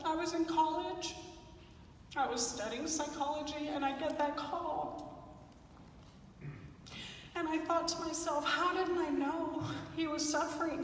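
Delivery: quietly choked-up recollection; real, near tears. Real, not acted. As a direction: remember a lost loved one, close to crying. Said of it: "I'm gonna cry right now".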